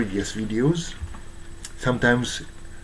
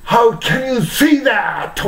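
high, loud drawn-out yell; 12 semitones higher, 6 dB louder, slower than usual. Retold or imitated. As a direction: re-enact a furious yell at someone hated. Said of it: "I yell".

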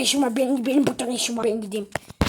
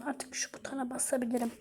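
sneaky whisper; 3 semitones lower, 14 dB quieter than usual. Acted. Sneaking to press the button.

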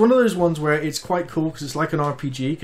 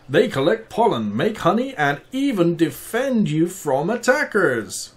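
higher, lightly voiced bear reading; 6 semitones higher than usual. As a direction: read on as the bear. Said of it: "I could do a bit of bear reading".

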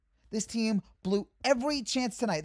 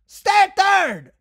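higher, louder, slow drawn-out shout; exasperated shout; 8 semitones higher, 9 dB louder, slower than usual. Real, not acted. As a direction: yell it at the runner who didn't listen. Yelling at the baserunner again.

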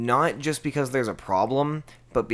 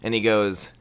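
ordinary talk

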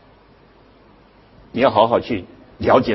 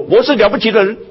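high, loud, swaggering claim of importance; mock loud self-important boast; 10 semitones higher, 4 dB louder than usual. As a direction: announce yourself like a big shot at a restaurant. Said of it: "他只是发出一个信号说".

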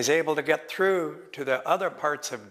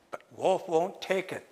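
usual speaking voice

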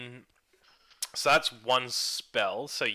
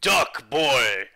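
shouted warning; 8 dB louder than usual. Acted. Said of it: "and I go".

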